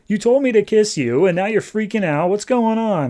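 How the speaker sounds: high-pitched exasperated retelling; retold indignant complaint. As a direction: act out a confused, put-out teenager lightly. Startled girlfriend confronts teen who freaks out.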